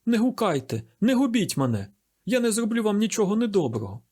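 high, anxious begging; acted plea with pitch held high; distraught pleading. A narrator's reading. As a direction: beg them not to give you away. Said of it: "пополотнівши й розгубившись".

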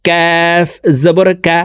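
কাফ made just forward of the tongue root; touch the palate for কা slightly ahead of the root. Shown as a demonstration. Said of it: "জিব্বার গোড়া থেকে একটু আগে বাড়াইয়া".

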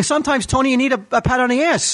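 mock pleading attendee.

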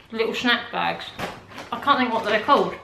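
talking between bites; chewing while talking. Real, not acted. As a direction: mouth partly full. Seen 2: bites cracker at -1.0, mouth working while speaking afterwards.

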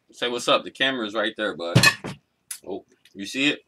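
ordinary talk

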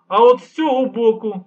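lightly voiced teacherly showing; instructive. A narrator's reading. Old man schooling the guards, mildly lecturing. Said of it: "повчально пояснив старий".

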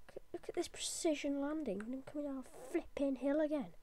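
normal talking voice

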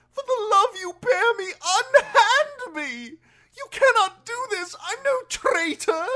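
high, loud, frantic pleading; desperate outcry. A narrator's reading. Prisoner begs the knight and guards to free him, desperate. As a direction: plead at the top of your voice, pitched very high. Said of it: "the prisoner said desperately".

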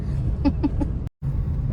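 short burst of unvoiced chuckling; breathy laugh. Real, not acted. Companion reacting to Ganpati shopping talk, breathy laugh. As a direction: chuckle breathily, barely any voice.

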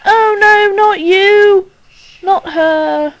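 loud, high cry of dismay; alarmed dread. Real, not acted. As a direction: cry out at the sight you dreaded. Dreads a scary figure appearing.

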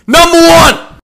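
loud, high shouted announcement; scream. Acted. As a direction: yell the number out at full volume. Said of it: "I'm gonna scream really fucking loud".

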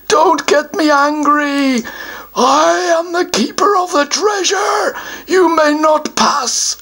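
a self-important troll puffing himself up; troll guardian. Acted.

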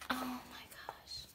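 breathless whispered oh my gosh; hushed, starstruck whisper; awed whisper. Real, not acted. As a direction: whisper it in disbelief. Whispering over the photos.

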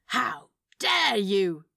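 clutch your pearls, theatrically; mock gasp. A narrator's reading. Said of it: "Crowley gasped".

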